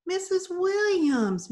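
high, drawn-out plea; pleading. A narrator's reading. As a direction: call her name imploringly, slowly. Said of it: "Mandy pleaded".

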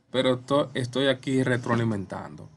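ordinary talk

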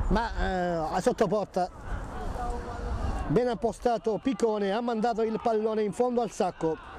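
ordinary talk

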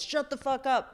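imitated street-corner comeback; New York street banter. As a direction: playfully tell a stranger off.

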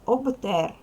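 Обтер high and loud with a voiced B before T; loud, distinct Обтер with a clear B; clear voiced consonant. Shown as a demonstration. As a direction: keep Б in Обтер ringing, never P. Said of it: "Вимовляй чітко".

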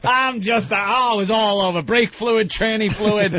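hammed-up disgust at being soaked; mock frantic complaining. Acting out covered in fluids.

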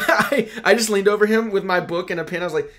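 usual speaking voice

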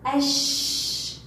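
"أش" with a long hissing sh, no L; silent lam. Shown as a demonstration. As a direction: hold the ش of أش very long. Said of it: "هل لفظتها؟".

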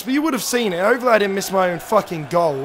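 ordinary talk, nothing marked

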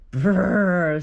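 loud rolled shiver noise; a big "brrr" from the cold; shivering brr. A narrator's reading. Badger tromping in from the cold, shivering brr. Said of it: "says Badger as he tromps inside".

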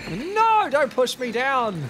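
high, loud protest with a drawn-out no; loud, high-pitched outburst; crying out. Real, not acted. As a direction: yell in protest as you're shoved. Crying out as pushed in game.

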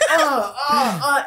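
hammed-up high grunts; mock grunting. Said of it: "And I'm just going".

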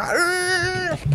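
mock choked-off yell, pitched very high; hammed-up strangled driver; strangled cry. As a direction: squeeze out a choking shout.

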